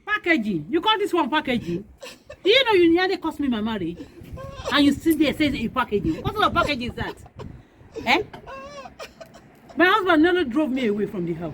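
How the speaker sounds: loud, indignant scolding; outraged. Acted. Woman confronting someone who nearly cost her marriage, outraged. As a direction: throw the word back in furious disbelief.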